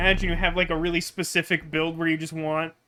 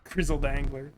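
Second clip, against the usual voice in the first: ordinary talk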